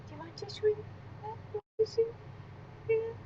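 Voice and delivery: hushed, high voice to the puppy; soft pet talk. Real, not acted. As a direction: murmur the offer gently to the dog. Asking the puppy softly.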